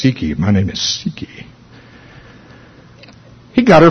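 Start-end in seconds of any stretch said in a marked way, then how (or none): 0.0-1.5 low, soft, eerie growl; the demon's deep voice saying Siki. Retold or imitated. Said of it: "it said in a deep voice".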